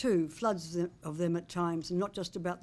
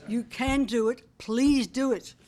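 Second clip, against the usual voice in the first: higher, louder rallying plea; urgent pleading; 5 semitones higher, 7 dB louder, slower than usual. Real, not acted. Devoted fan begging her team for wins, urgent pleading. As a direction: urge them on, building into a loud plea. Urges team to win three more.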